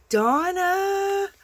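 high, loud delighted cry of the name; thrilled, squealed name; excited squeal. Real, not acted. Calling out the sender's name.

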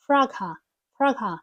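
"frog hop" with a light h, "frogop"; linked words. Shown as a demonstration. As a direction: barely breathe the h of "frog hop". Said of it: "з'єднуємо ці два слова".

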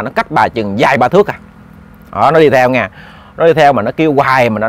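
usual speaking voice

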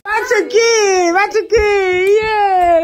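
high loud triumphant cheer; excited cheer. Real, not acted. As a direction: shout the win. Celebrating the prank.

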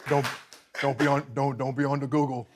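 mumbled warning, spaced out with big gaps; Shaquille O'Neal. Retold or imitated. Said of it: "Shaq goes, he goes".

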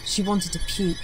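plain reading voice

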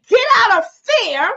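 high, loud command; preacher voicing God's command to the flock; commanding call. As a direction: order them out of fear.

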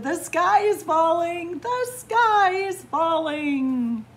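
high, loud shout, stretching the last repeat; excited group shout. A narrator's reading. Farm animals dancing in a conga line, shouting. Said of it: "They shouted".

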